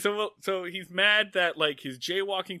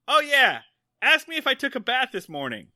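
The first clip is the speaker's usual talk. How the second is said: put-on high, loud, eager little kid; Opie; 8 semitones higher, 6 dB louder than usual. Host playing a child who remembers his joke, eager. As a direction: pipe up like a boy telling a joke. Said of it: "So Opie comes in".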